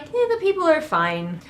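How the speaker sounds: high, dismissive little shrug of a voice; mock indifference. Acting out everyone else's indifference.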